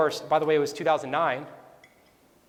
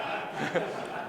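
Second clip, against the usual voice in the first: a soft, unvoiced chuckle; breathy laugh; 6 dB quieter than usual. Real, not acted. Laughing quietly to the audience at his own story.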